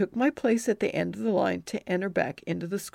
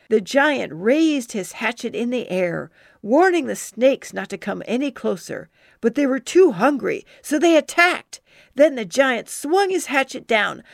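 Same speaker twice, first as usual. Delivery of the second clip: dramatic storytelling; 5 semitones higher, 6 dB louder than usual. Acted. Child telling giant tale to kids.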